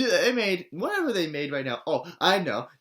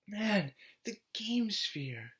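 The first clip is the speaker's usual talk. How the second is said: slow, hushed, reverent whisper; 5 semitones higher, 11 dB quieter, slower than usual. Real, not acted. Vlogger whispering about the toy in awe. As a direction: breathe the name like something sacred. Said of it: "awed whisper about the toy".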